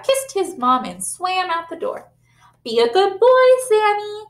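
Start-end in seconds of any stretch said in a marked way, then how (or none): 2.6-4.2 high, loud call; mother calls after son leaving for school; calling after him. Acted. Mother saying goodbye, loud call. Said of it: "cried his mother".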